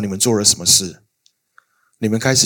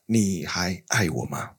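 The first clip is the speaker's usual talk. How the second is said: slow, much softer wounded asking; soft sad plea; 6 semitones lower, 10 dB quieter, slower than usual. Retold or imitated. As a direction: ask it slowly, barely holding together. Wife's plea to husband, retold. (轻声哀伤地问)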